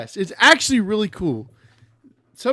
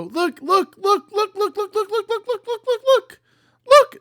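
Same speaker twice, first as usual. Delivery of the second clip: rising higher and higher look look; frantic excitement; 6 dB louder than usual. Real, not acted. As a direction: pitch climbing, frantic chant. Frantic at many copies.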